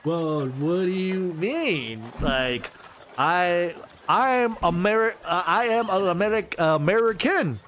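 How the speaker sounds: slow, halting put-on American accent; forced American accent. Shopkeeper insists he is American in a forced American accent. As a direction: stumble through it, straining to sound American. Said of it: "why are you talking like that".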